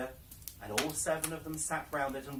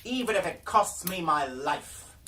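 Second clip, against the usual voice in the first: higher and louder, still raging; furious cry; 6 semitones higher, 9 dB louder than usual. Acted. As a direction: spit out the vow in anger. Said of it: "she cried".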